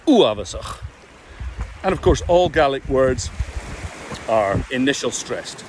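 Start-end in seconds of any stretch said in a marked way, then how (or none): 0.1-0.8 Uavasach with a short, punched first syllable and a final ch; stress on first vowel. Shown as a demonstration. Coach giving the correct pronunciation to students. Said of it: "if you can combine all that".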